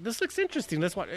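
imitating eager interest. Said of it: "the first time we're like".

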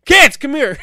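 loud, high parental call; mock yelling. Guilty father summoning the kids for questioning, mock yell. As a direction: holler for the children, putting it on. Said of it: "I'm yelling at, not yelling".

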